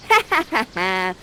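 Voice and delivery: loud stagey villain cackle; little cackle. Acted. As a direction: cackle in triumph, each ha distinct. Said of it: "gives a little cackle".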